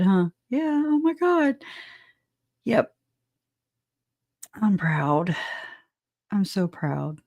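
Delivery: ordinary talk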